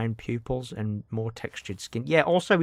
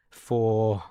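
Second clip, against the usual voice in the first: a quick West Country twang on one word; a light West Country accent on one word; 2 semitones lower than usual. Pretend. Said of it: "I like my West Country accent there".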